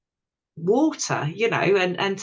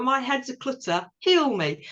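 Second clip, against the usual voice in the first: higher-pitched mock plea; playful, raised-pitch begging; demanding self-quote; 6 semitones higher than usual. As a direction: act out pestering the woods for healing. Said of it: "go into the woods and go".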